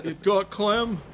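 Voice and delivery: high, distraught whimper; acted tearful panic; sobbing. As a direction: play a frightened boy breaking down. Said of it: "he's fucking just sobbing".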